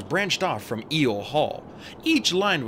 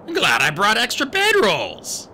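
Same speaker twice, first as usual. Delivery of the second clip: pleased satyr; 8 semitones higher, 6 dB louder than usual. A narrator's reading. Grover still triumphant.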